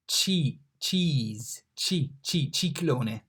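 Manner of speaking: ci as chee like cheese; chee, chee, ciclone; drilling the ch sound; Italian ci. Shown as a demonstration. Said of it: "pronounced like C in cheese".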